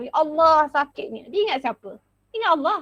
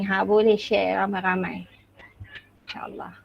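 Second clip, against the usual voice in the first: whisper; 6 semitones lower than usual. Real, not acted. Aside to audience. (berbisik perlahan kepada hadirin)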